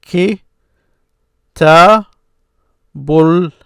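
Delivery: Ki, ta, bul broken into separate beats; teacher sounding out syllables. Shown as a demonstration. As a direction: split the word into syllables. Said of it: "I will put diacritics on it".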